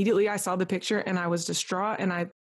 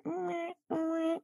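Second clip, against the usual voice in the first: thin, high, soft mewing like a newborn kitten; weak cat cry; 10 semitones higher, 7 dB quieter than usual. Retold or imitated. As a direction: imitate a tiny cat's meow, high and faint. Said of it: "It literally sounded like a cat".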